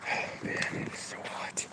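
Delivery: breathy whisper, worn out by the heat. Real, not acted. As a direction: whisper it, drained. Complaining of the heat.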